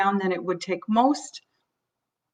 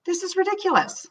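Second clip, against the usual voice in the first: much higher, softer exasperation; acted exasperation, pitched way up; exasperated; 9 semitones higher, 4 dB quieter than usual. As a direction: toss it off in disbelief. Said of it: "your own sense of panic".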